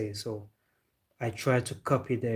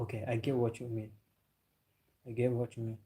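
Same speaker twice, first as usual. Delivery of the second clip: normal talking voice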